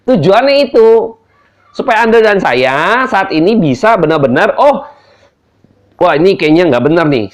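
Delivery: loud, high fired-up preaching; preaching at full force. Acted. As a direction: preach it hard to the crowd.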